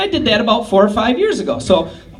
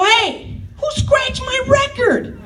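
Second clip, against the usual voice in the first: mock angry yell; much slower than usual. Big brother yelling at little Tom about his record, mock yell.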